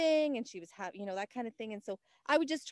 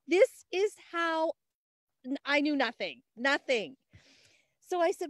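usual speaking voice

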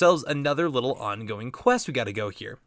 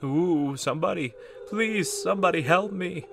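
higher, wailing spooky plea; moaning ghost; 8 semitones higher than usual. Acted. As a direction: moan for paper like a ghost. Voices the toilet-hand ghost.

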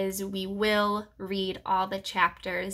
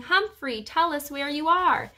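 higher-pitched call, only a bit louder; mildly raised voice calling out to the missing one; 6 semitones higher than usual. A narrator's reading. Boy calls to the lost hamster, loud calling. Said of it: "one voice was so loud it was unmistakable".

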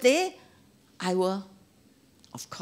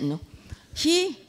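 ordinary delivery

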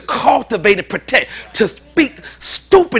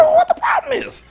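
put-on whiny boy yell. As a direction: squeal it like a sulky kid. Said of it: "I said".

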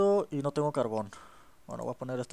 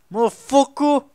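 angry yell; 9 semitones higher, 9 dB louder, slower than usual. Real, not acted. Swearing mid-fight.

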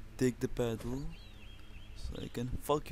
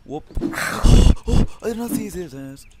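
louder, higher startled cry; startled outburst; 7 semitones higher, 16 dB louder than usual. Real, not acted. Gamer caught off guard by a zombie, yelping in alarm. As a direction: jolt of alarm at the surprise zombie. Zombie jumps him suddenly.

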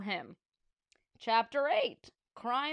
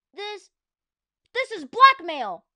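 high, loud outraged protest; outrage; 10 semitones higher, 14 dB louder than usual. Acted. George protests the principal's demands, loud outrage. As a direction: burst out in shock.

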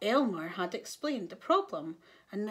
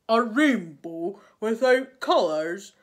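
loud, slow, weighty tiger voice; grave tiger; 10 dB louder, slower than usual. A narrator's reading. Tiger reacts to Elmer's news of the rainbow, gravely. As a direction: pronounce it slowly and forcefully. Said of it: "said the tiger".

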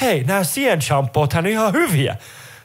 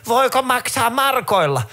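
put-on old granny; 9 semitones higher, slower than usual. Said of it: "mummo siellä sun edessä silleen".

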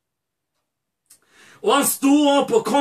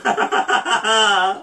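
delighted burst of laughter; joyful laugh; 3 semitones lower than usual. Real, not acted. Preacher moved by the father seeing his son, joyful laugh.

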